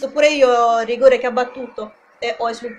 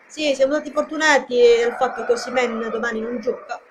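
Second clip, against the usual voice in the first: usual speaking voice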